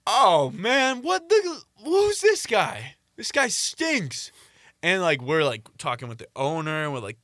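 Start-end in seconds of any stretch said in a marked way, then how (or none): 0.1-4.3 high-pitched mock indignation; put-on high, drawn-out disgust; feigned outrage. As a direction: act appalled at a total stranger, pitched way up. Feigning disgust to disown their friend.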